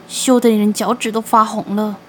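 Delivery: plain reading voice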